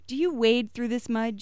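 exasperated mother yelling. Acted. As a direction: scold the child over the mud. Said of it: "she yelled at me".

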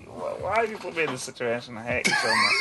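squealing with laughter while protesting; helpless laughter. Real, not acted. Player to game master over the accent task, laughing protest. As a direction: laugh through a mock complaint, voice shooting high.

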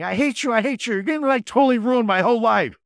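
mock-shriek like a furious fourteen-year-old; teen screaming. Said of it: "started screaming at her, shouting".

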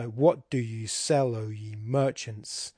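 slow, measured beat, stressing WHAT, SELL and MERCHANTS; dactylic scansion. Shown as a demonstration. Lecturer scanning the poem's line, slow dactylic stress. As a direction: recite "what do you sell, O ye merchants" slowly in waltz time. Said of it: "a stress syllable followed by two unstressed".

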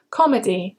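Comedy stressed on COM, two syllables; stress on first syllable. Shown as a demonstration. Teacher models word stress for learners, first syllable hit. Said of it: "Stress the first syllable when you say comedy".

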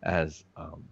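usual speaking voice

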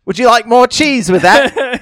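slow, loud, high-pitched Aussie server; mock Australian with laughter in it; fake Australian accent. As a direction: ham up a bad Australian accent. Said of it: "pretty sure that guy is not Australian".

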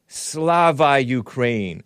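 very slow mock Ukrainian accent; mocking Zelensky's accent, drawn out; imitated Ukrainian accent. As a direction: stretch it out in a playful Ukrainian accent. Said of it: "I'm imitating it and mocking it".

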